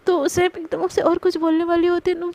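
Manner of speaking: usual speaking voice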